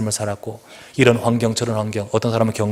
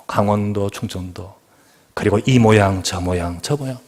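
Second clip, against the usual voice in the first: ordinary talk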